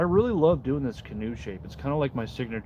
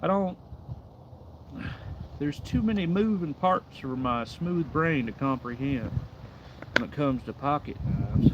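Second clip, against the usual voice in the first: a hint of his old North Carolina boss, played silly; North Carolina supervisor accent; 2 semitones higher than usual. Streamer joking about his smooth brain, in a light North Carolina accent. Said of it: "I realize I do that voice".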